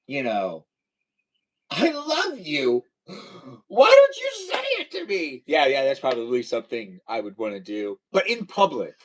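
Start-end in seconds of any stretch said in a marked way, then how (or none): 1.7-5.4 mock plea. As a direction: ham up a needy plea to a wife. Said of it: "No, I'd probably say".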